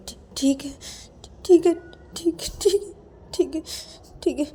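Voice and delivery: high, stammering, crying assent; acted tearful, broken-up agreement. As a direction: give in meekly through tears. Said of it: "क्यों, क्यों रो रहे हो?".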